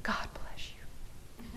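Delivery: slow, breathy holy whisper; mock angelic whisper. Business owner suddenly turning pious, mock whispered. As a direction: whisper it like a fake saint. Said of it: "then turn around and say".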